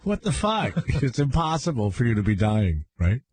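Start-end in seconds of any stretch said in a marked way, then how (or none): 0.0-0.8 high, baffled mock disbelief; two people stunned by his overreaction; disbelieving. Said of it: "They stared at each other like".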